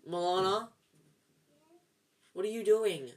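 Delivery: exasperated, questioning call; real annoyance. Real, not acted. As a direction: break off to challenge an interrupting sibling. Interrupted by sister while recording.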